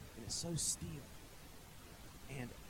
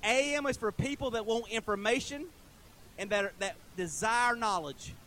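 emphatic declaration; 8 semitones higher, 9 dB louder than usual. Real, not acted. Continues AM radio defence.

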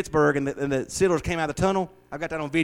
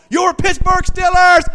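much higher, much louder stadium call; announcer shout; 14 semitones higher, 11 dB louder, slower than usual. Retold or imitated. Preacher imitating the Steelers announcer, big shout. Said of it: "there's no noise at all".

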